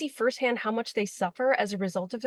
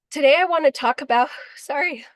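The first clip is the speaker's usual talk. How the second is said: choked up; 5 semitones higher, 7 dB louder than usual. Real, not acted. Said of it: "I get so passionate and emotional".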